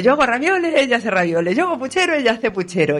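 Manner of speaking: high sing-song whine, mocking the copycat; imitating Elvira. Elvira complaining about the copying neighbour, high mocking whine. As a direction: jump up high on each "yo" line. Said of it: "dice Elvira".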